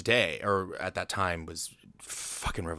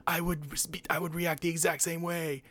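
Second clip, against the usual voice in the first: pitched way up, eager and giddy; high excited agreement; 10 semitones higher than usual. Retold or imitated. Fan imagining meeting Walt, high giddy agreement. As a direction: squeak it like a fan who would do the same. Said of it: "in my head, I'm like".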